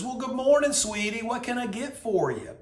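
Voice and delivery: high-pitched, sweet Southern server; Southern lady. Retold or imitated. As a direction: greet him like a sweetie at the drive-thru, pitched high. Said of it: "moseys over to the window very slowly".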